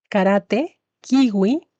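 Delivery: normal talking voice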